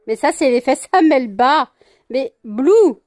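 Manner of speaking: loud protest at the pet; exasperated scolding. Real, not acted. Owner to misbehaving pet, loud exasperated reproach. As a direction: raise the voice to reproach Blue. Scolding Blue mid-scuffle.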